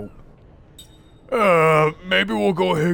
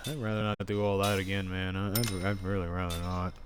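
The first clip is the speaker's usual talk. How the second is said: weary mumble; 7 semitones lower, 8 dB quieter than usual. Real, not acted. Weary about repeating the chore.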